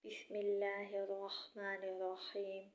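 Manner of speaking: ordinary delivery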